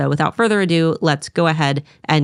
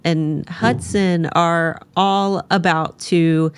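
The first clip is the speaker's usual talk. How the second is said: ordinary delivery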